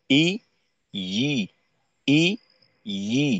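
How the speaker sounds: ই with a hard glottal catch, then ই soft; hard against soft. Shown as a demonstration. Teacher contrasting hamza and ya, hard against soft. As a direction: start ই hard from the throat, then softly. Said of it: "এভাবে".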